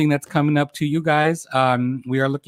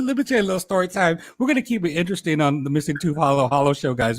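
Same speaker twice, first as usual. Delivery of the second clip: ordinary delivery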